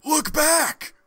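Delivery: high urgent cry of look back; shouted warning. Acted. Comic reader shouting a warning to the hero. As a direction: yell it out in alarm. Acting comic dialogue aloud.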